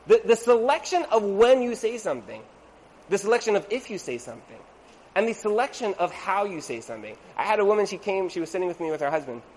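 ordinary delivery

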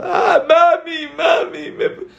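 put-on high, drawn-out wail of a six-year-old; mock child crying. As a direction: imitate a little girl wailing for mommy. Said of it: "la hermana de seis años está llorando".

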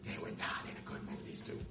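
hushed whisper. Real, not acted. Rabbi confiding to the audience about the grumpy ladies, whispering. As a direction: lean in and whisper it.

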